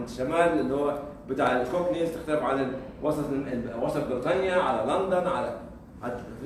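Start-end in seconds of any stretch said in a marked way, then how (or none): none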